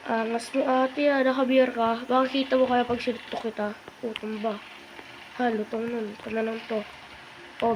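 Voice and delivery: normal talking voice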